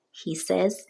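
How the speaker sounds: plain reading voice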